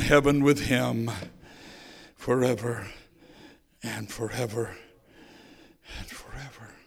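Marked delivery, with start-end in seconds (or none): 2.2-4.8 each forever lower and softer, dying away; a hushed, fading echo. Real, not acted. Closing altar call, fading out. 6.0-6.7 forever barely breathed, much softer; a fading hushed close; almost silent. Real, not acted. Repeated phrase, final take.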